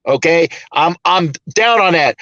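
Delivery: normal talking voice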